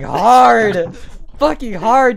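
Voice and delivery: HARD drawn out and loud; hard, emphatic. Shown as a demonstration. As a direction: punch one long, loud word. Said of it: "I fucking hit the ground hard as hell".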